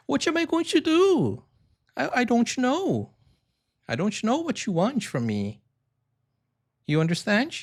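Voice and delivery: high, exaggerated Brazilian-Portuguese accent; sing-song Brazilian-accented English. Shown as a demonstration. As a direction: demonstrate a Brazilian accent, pitch way up. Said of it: "if I add the accent".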